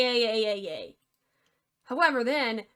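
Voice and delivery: usual speaking voice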